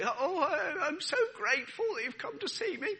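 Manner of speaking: high, weak sickly voice with a whimper; ill voice. Pretend. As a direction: sound like a frail patient in a hospital bed. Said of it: "That's my ill voice".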